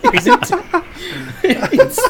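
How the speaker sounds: high, squeaky New Zealand voice; New Zealand accent. Acted. Host answers roll call in a high mock New Zealand accent. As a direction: pitch way up into a mock Kiwi. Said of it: "that's my New Zealand".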